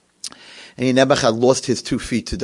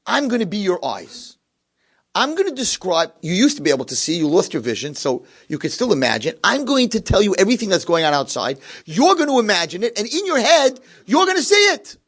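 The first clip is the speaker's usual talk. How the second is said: building from raised voice to high, loud peak; rousing promise; 9 semitones higher, 3 dB louder than usual. Retold or imitated. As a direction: sell the vision with mounting fervour. Roommate makes his rousing promise.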